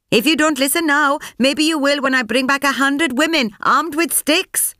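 high, pressing threat; threatening. A narrator's reading. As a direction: warn him, high and forceful. Threatening the officer.

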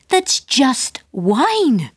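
slow high amazed drawl; astonished. A narrator's reading. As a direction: stretch it in wonder. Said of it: "he said, astonished".

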